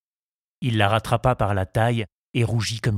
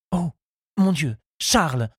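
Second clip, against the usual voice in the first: higher, startled cry, not shouted; shocked exclamation; 7 semitones higher than usual. A narrator's reading. Henriette sits up after the kiss, exclaiming. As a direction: exclaim as if scandalised. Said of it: "s'écria-t-elle en se redressant".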